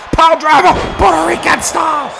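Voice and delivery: high, urgent wrestling call; excited play-by-play. Acted. Commentator calling a possible title win, thrilled. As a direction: call it as a title-winning moment.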